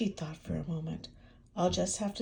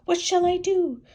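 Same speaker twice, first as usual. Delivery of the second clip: panicked hen; 11 semitones higher, 8 dB louder than usual. Acted.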